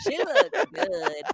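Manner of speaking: slow, high put-on voice; mock admiring. Cohost mimicking Cindy praising her, slow and high. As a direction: drawl it out like a gushing fan.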